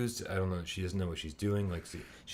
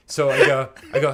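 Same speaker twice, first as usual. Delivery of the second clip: normal talking voice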